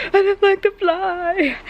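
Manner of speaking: high-pitched mock wail of a scared little kid; imitated child's scream. Mother retelling her daughter's fright at a fly, mock high wail. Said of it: "she suddenly screams".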